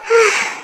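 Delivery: acted exasperated sigh; heavy sigh. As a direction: let out a long, audible breath. Mother sighs after daughter's plea.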